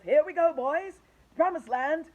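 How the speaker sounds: loud, high whoops; excited shouts. Acted. The company shouts as they set off, loud and excited. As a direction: cheer as you climb. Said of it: "shouts of".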